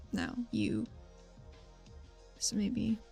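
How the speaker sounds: normal talking voice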